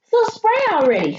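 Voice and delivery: high, exasperated outburst; sputtering. A narrator's reading. Boy urging his family, high and exasperated. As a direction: blurt it out, fed up. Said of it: "sputtered Oliver".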